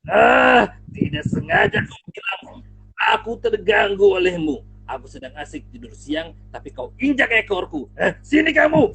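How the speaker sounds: loud, roaring king of the jungle; furious lion. Acted. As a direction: bellow at the trembling mouse. Singa murka pada tikus. (singa murka, suara keras menggelegar)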